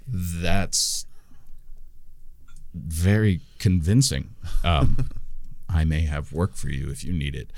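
smooth, low refusal; deep-voiced gnome. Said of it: "uncomfortably deep voice".

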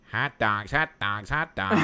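pinch the nose and chant the vendor call; nasal vendor call, nose held. Retold or imitated. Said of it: "let me hold my nose".

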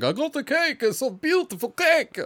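high, proud cake guy in a bad German accent; hammed-up German cake guy. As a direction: show off the cake in mock German. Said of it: "this is my best German accent".

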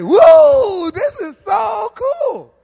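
high, loud whoop, then slow and high; thrilled churchgoer, high whoop; excited whoop. Retold or imitated. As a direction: cheer like a thrilled newcomer. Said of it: "to say".

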